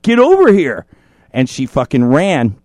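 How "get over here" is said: mock-aggressive shout, higher and louder; aggressive creep. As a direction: bark it like a pushy harasser. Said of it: "getting aggressive and pissy".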